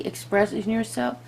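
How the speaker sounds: ordinary talk, nothing marked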